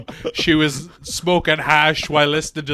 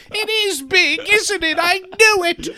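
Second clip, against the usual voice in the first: much higher, mock-proud voice; proud groom; 11 semitones higher than usual. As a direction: crow it high and silly. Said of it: "groom boasts pathetically".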